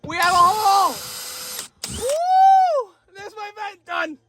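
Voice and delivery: high, loud whoop of success; thrilled the vent hole is cut; triumphant. Real, not acted. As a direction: celebrate the finished hole.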